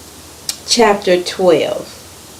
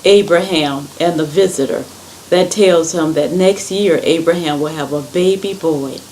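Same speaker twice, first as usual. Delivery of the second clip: usual speaking voice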